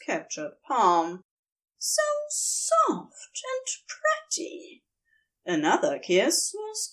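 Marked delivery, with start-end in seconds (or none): 1.8-4.8 Aziraphale voice. A narrator's reading.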